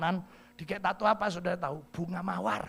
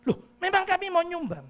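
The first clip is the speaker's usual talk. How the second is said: high brash auntie; 10 semitones higher, 5 dB louder than usual. Retold or imitated. Auntie assuring the pastor she will donate, loud and high.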